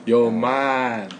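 very slow, stretched words; slow drawl. Shown as a demonstration. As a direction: drag it out slowly on purpose. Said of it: "又长又慢".

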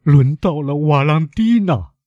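slow, high-pitched lament; grief-stricken cry, words stretched; anguished outburst. A narrator's reading. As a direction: wail it out in despair. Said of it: "一声痛苦的呜咽".

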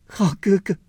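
put-on high, tearful young girl's voice; tearful plea. A narrator's reading. Daiyu pleads through tears with grandmother, high tearful voice. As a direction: cry out in disbelief. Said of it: "拉着宝玉哭道".

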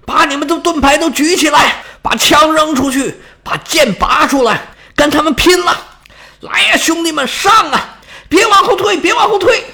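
high battle commands, climbing to frantic cries; shouting to soldiers. A narrator's reading. General rallies his failing troops, building urgent shout. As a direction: drive the men on, pitch rising with each order. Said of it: "大声冲着自己的士兵".

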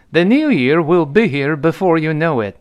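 clearly projected English model reading; model sentence. Shown as a demonstration. Teacher models a dialogue line for learners, model sentence.

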